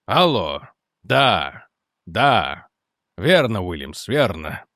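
low-pitched, terse phone replies; officer on phone. Acted. General answers a phone call. (низким голосом отвечает по телефону)

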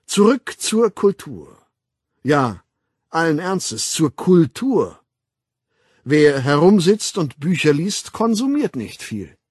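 loud, high, rhetorical flourish; lecturing World Controller. Acted. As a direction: proclaim it, stretching the key words. Weltaufsichtsrat doziert. (laut deklamierend)

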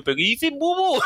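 high laughing yell at a friend; laughing shout. Retold or imitated. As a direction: tease him, voice cracking with laughter. (grito burlón entre risas, muy agudo)

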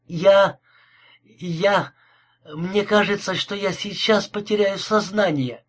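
mildly acted feminine distress; faint, faltering. A narrator's reading. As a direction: falter on the first words, as if about to faint. Heroine speaks as if about to faint.

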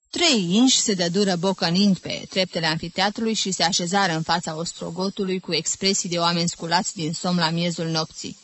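ordinary delivery

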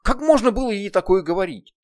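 high, loud stress on можно; angry reproach. Acted. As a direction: lean hard on можно in anger. Heroine berates demon.

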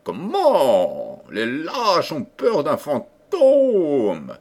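high, slow, shrill outburst of the prince; a prince's piercing, disdainful cry. A narrator's reading. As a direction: spit the words out in a piercing voice. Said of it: "d'une voix stridente".

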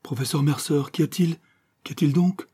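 high, urgent questions, climbing; frightened young woman imploring; anxious pleading. A narrator's reading. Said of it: "adjura-t-elle".

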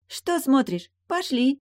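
high-pitched, bossy mermaid call; impatient mermaid. Acted. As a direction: summon them in a high voice. Mermaid calls them to follow.

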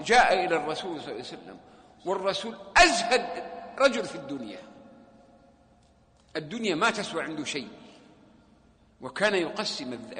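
ordinary talk

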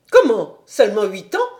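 be outraged at the answer; indignant. A narrator's reading. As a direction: governess indignant at the child's age, loud. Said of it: "avec une certaine indignation".

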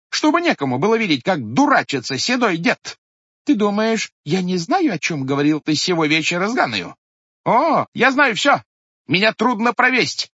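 high, loud, accusing tirade; angry old woman. A narrator's reading. Sister-in-law berating the head, high and loud. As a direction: scold him, lingering on the threats.

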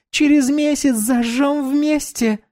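put-on bright high young woman; high girl voice. Dominika voiced.

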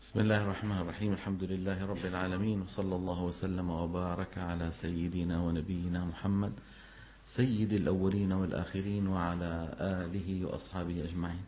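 soft, low opening blessing; formal invocation. Acted. Teacher opening the lesson with a hushed blessing. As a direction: recite the opening praise quietly.

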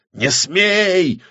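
high-pitched outcry of protest; crying out. Acted. Авадонна forbids the witch, crying out. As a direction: cry it out to forbid. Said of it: "выкрикнула Авадонна".

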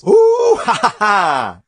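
high, loud whoop into laughter; exultant whoop and laugh. Real, not acted. Preacher rejoicing over fullness in all areas, whooping laugh. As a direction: let out a joyful whoop, then laugh. Exults at being made whole.

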